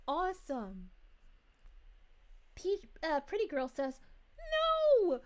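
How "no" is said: high, loud drawn-out "no"; hammed-up wail of protest; mock outraged cry. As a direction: read it as a dramatic protest. Acts out viewer's outraged comment.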